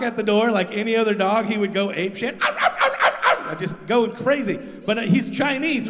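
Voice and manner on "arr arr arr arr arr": yappy barks mimicked; dog barking imitation. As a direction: bark like a small dog.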